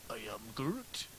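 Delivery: low, soft creature voice; quiet impression of a tree creature, pitched well down; Groot. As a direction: drop low and hushed for the character. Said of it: "Guardians of the Galaxy".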